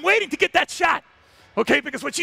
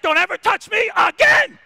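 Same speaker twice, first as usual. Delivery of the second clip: higher, louder yell; furious shout; 8 semitones higher, 6 dB louder than usual. Retold or imitated. Lioness yelling at the male, loud and high. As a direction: bark it at him, loud. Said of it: "she's like".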